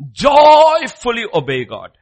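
loud, high and slow, leaning on joyfully; emphatic command. Real, not acted. Preacher urging young men to obey God, loud and slow. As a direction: proclaim it as a charge.

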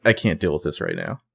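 soft, low, worn-out retreat; defeated. Retold or imitated. Spencer gives up on her friends, soft defeated sigh of a line. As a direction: let it drop, exhausted.

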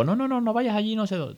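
high, loud alarmed parent warning; a parent calling a child back in a panic. Retold or imitated. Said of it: "momento de esto de tensión".

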